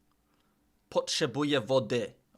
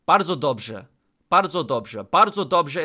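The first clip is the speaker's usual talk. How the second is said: excited; 2 semitones higher, 8 dB louder than usual. Real, not acted. Cheering own correct answer.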